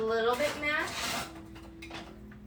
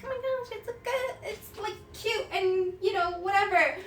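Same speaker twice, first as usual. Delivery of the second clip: much higher, softer mock gush; airy past self; 8 semitones higher, 4 dB quieter than usual. Imitating herself choosing the tattoo, high and gushing. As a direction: play your younger self cooing over a pretty tattoo. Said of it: "and so I was like".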